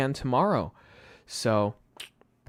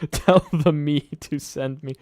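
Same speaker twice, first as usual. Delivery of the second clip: laughing; 4 semitones higher, 7 dB louder, slower than usual. Real, not acted.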